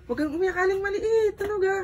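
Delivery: high cooing voice; cooing baby-talk. Acted. Vlogger cooing to Aling Maliit. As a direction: soothe a crying toddler.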